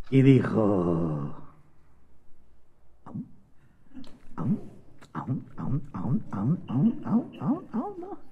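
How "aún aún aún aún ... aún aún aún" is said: tiny, soft rising munching sounds; hushed little gobbling noises; mimed eating noises. Acted. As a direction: nibble softly, each bite curling upward. María eats the soup in nibbles.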